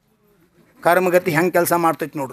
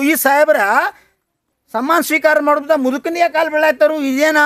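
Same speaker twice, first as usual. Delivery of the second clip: higher, wondering voice; astonished; 9 semitones higher than usual. A narrator's reading. The staff wonder why the officer bows to the old man, amazed. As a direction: ask it in raised-pitch amazement. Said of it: "ಆಶ್ಚರ್ಯದಿಂದ ನೋಡಕ್ಕೆ".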